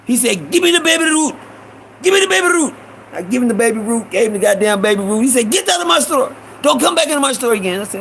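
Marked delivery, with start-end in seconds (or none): 0.5-2.7 high, insistent demand; angry Iranian shopkeeper. Retold or imitated. Shopkeeper demands the candy back from boy, imitated high and angry. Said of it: "it was the Iranian motherfucker". 5.5-7.8 high, angry banishing; angry Iranian shopkeeper. Retold or imitated. Shopkeeper throws boy out of store, imitated high and angry. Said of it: "He getting mad".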